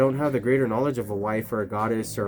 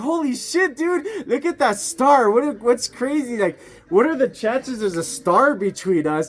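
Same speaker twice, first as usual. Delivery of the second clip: much higher and louder, laughing in mock wonder; amazed; 10 semitones higher, 3 dB louder than usual. Retelling to his friend how he gasped at the star, excited. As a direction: relive the awe of spotting a star. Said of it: "I was like".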